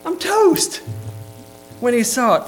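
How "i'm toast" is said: high, loud mock defeat; deflated I'm toast. Preacher voicing Isaiah in slang, high mock defeat. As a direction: throw up your hands, done for. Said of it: "is how we would say it".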